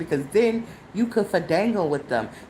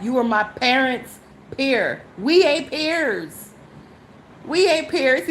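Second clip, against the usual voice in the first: firm rebuke; 5 semitones higher than usual. Real, not acted. Telling the older man they are not peers. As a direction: draw the line, indignant.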